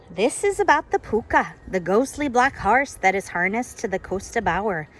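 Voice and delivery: loud hammed-up ghost story voice; father telling a spooky tale. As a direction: lean in like a dad spinning a ghost story. Reads dad's ghost story aloud.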